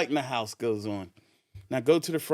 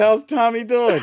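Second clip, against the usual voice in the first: high, loud, forced-casual question; nervous cheerful reply; 10 semitones higher, 8 dB louder than usual. Retold or imitated. Derek calls down to the cop, high and loud. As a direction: fake calm under pressure. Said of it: "I said".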